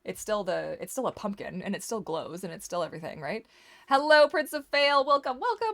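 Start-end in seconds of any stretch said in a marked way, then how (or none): 3.9-5.7 warm welcoming host. Real, not acted. Greeting a viewer in chat.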